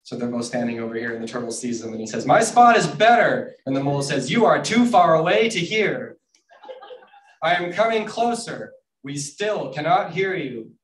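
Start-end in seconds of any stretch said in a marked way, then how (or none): 2.3-3.5 high, loud, drawn-out boast; full storybook shout; shouting across distance. Acted. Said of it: "they have to shout at each other". 4.3-10.7 high, loud call across a distance; raised, stretched storybook shout; shouting back. Acted. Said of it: "they have to shout at each other".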